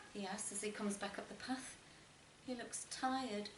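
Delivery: ordinary delivery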